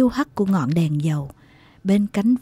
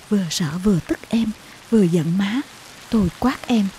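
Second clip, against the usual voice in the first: plain reading voice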